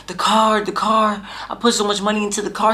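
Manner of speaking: panicked wail. Retold or imitated. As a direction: wail about the wrecked car. Panicked over lost car.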